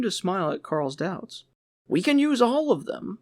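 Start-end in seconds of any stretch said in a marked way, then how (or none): 1.9-3.2 higher, louder, smiling reassurance; amused manager. A narrator's reading. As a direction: laugh off his doubts.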